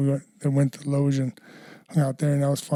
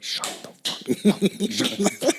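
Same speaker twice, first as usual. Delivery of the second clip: very slow, drawn-out, higher-pitched mock hushing; retold exasperated husband; 5 semitones higher, much slower than usual. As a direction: imitate a husband begging for silence, stretching each word. Husband silences his drunk partner.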